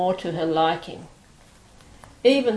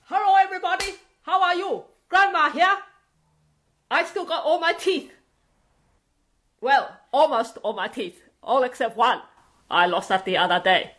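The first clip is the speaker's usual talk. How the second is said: high, exaggerated Chinese English accent of an old grandma; 7 semitones higher than usual. Performer showing her clown grandma character, Chinese accent. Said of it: "who speaks something like this".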